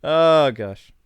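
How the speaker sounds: usual speaking voice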